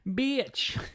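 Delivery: mocking echo. GM echoes the player's outburst.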